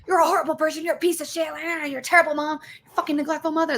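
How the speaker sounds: high, sneering impression of the husband; mocking husband. Imitating her husband berating her as a mother. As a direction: voice him high and nasty, mocking. Husband's abuse voiced in story.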